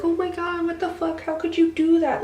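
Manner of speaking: high-pitched mock horror; hammed-up appalled reaction; imitated disgusted crowd. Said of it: "everyone was disgusted with me".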